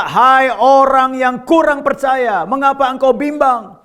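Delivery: high, proclaimed rebuke; rebuking as Jesus. Acted. Jesus reproving Peter for doubting, high slow rebuke. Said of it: "Segera Yesus ... dan berkata".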